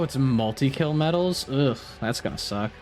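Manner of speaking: ordinary delivery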